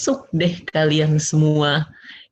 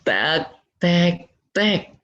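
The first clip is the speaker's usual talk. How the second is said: knocking like a street vendor; 5 semitones higher, much slower than usual. Shown as a demonstration. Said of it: "kayak tukang nasi goreng".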